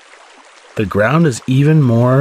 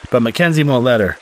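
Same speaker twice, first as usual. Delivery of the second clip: ordinary delivery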